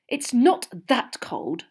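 snippy, drawn-out complaint; irritable. A narrator's reading. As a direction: say it crossly, stretching the words. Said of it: "said Hermione irritably".

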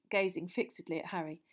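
usual speaking voice

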